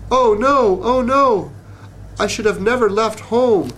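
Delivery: high, lightly moaning little bug; a worried bug far from home, moaning. A narrator's reading. The homesick bug regrets leaving home, moaning high. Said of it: "the bug moaned".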